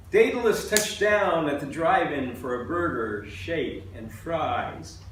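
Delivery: measured, emphatic poem recital; poetry reading. Acted. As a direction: read it out as a poem to an audience. Reads own poem to audience.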